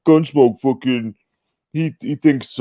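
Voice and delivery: griping in someone else's voice; mock complaining. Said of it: "he's, he's complaining".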